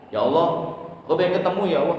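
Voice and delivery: normal talking voice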